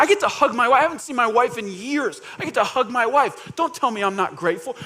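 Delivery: loud, high start to an indignant protest; emotional pushback. Acted. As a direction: protest passionately that you are grateful. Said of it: "Emotionally, I think they would push back".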